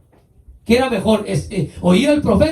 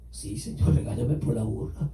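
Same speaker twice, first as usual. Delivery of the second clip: whispered, lowered plea to God; a hushed, humble 'scold me' prayer; 9 semitones lower, 9 dB quieter than usual. Pretend. Believer praying to God, whispered humble plea.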